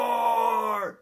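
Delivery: loud, high, held roar; dinosaur roar. Shown as a demonstration.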